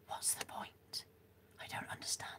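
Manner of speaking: breathy whisper, no voice. Shown as a demonstration. Testing a whisper on her viewers, soft whisper. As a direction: whisper it softly to the camera. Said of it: "Why would you whisper?".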